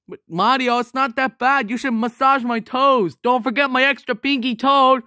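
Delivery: high-pitched wheedling protest; insistent coaxing. Acted. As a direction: push back and press the favour. Coaxing Mario to massage his toes.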